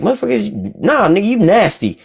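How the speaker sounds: high-pitched mock disgust, pitch jumping up; disgusted outburst. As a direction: recoil at something gross.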